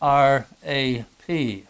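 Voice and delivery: R A P spelled letter by letter, slowly; slow, deliberate letter-by-letter spelling. Shown as a demonstration. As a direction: spell "R A P" out one letter at a time. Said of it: "You have".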